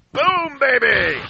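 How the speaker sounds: booming hype announcer shout; slow, huge arena hype call; monster truck ad. Acted. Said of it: "turn into a monster truck announcer?".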